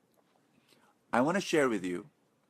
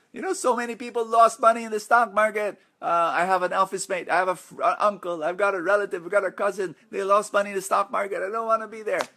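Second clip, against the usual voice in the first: higher pitched and louder, fretful listing of relatives; imitating a worried skeptic; 8 semitones higher, 4 dB louder, faster than usual. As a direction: act out someone who refuses the stock market. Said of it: "That's the reaction of a lot of people".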